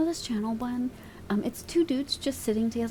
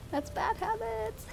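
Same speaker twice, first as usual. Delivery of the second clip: much higher, softer, slow playful scolding; squeaky mock scold; 13 semitones higher, 6 dB quieter, slower than usual. Streamer repeating the scolding to chat, squeaky and playful. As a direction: squeak it out like a fake-stern aunt.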